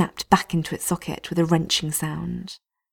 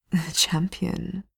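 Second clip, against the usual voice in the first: slow, whispered savouring of the word; with relish; 4 dB quieter, slower than usual. Acted. Captor admiring his prize prisoner, whispering with relish. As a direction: taste the word like a treat. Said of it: "he says with relish".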